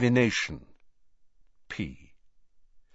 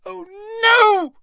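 high loud squeal; 9 dB louder than usual. Acted. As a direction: squeal in panic. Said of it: "squealed Hermione".

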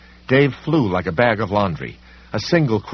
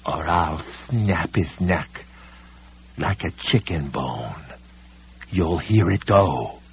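menacing villain voice; 4 semitones lower, slower than usual. Acted. Villain threatens in low voice.

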